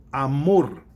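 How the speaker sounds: amor with the final MOR punched loud and long; stress on the last syllable. Shown as a demonstration. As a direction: lean hard on the MOR of amor. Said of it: "É a sílaba forte".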